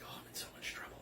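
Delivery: whispered mock-dread to herself; worried whispered aside. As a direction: whisper it like a private uh-oh. Worried thought while being questioned.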